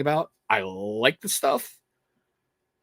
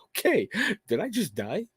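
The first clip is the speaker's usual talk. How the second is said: higher, half-joking am-I-dead question; mock disbelief; 7 semitones higher, 3 dB quieter than usual. Imagining a perfect day to viewers, mock baffled. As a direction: play it as baffled luck, lightly. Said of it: "I start going".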